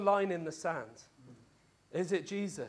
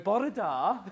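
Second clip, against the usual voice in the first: lilting, hammed-up Welsh accent; 4 dB louder than usual. Preacher teasing the congregation with a mock Welsh greeting, Welsh accent. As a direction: put on a playful, sing-song Welsh accent. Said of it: "in a Welsh accent".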